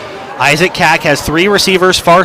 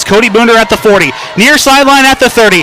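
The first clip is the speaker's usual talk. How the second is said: shouting, much higher, building excitement; touchdown call; 8 semitones higher than usual. Real, not acted. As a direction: scream the play as he breaks free. Live punt return touchdown call.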